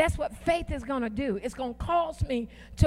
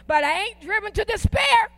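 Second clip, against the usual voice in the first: higher, punchy refusal with attitude; defiant declaration; 5 semitones higher, 3 dB louder than usual. Shown as a demonstration. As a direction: declare it high, leaning hard on AIN'T. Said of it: "I'm declaring it".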